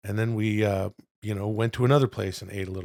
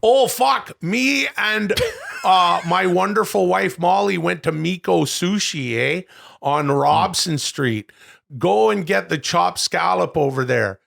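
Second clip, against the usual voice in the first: put-on higher, louder playful Canadian accent; mock Canadian; 5 semitones higher, 4 dB louder, slower than usual. Host recounting the trip to his co-host in a playful Canadian accent. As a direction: tell the sushi trip as a Canadian, eh.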